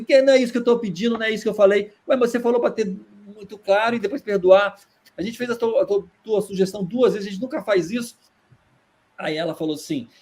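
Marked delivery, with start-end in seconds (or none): 0.0-1.9 louder and higher, fast indignant protest; furious protest. Retold or imitated. Angry audience woman confronting director Boal, loud and higher. 2.1-8.2 higher, defensive pushback; exasperated director. Retold or imitated. Boal defending himself to the woman, higher and exasperated.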